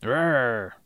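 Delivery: the word "Roar" said as a growl; a deadpan growl. Acted. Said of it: "You wanna try that again?".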